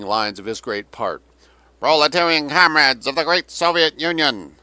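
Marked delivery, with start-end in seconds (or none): 1.8-4.6 loud, high, tinny speaker announcement; metallic loudspeaker voice. A narrator's reading. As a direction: blare it through a loudspeaker. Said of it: "the metallic voice".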